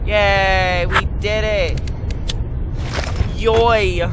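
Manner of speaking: loud, high cheer; celebrating. Real, not acted. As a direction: cheer out a drawn-out victory. Celebrating a game success.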